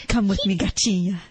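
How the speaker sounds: a hint of the pilot's smooth come-on; mock Brazilian pilot.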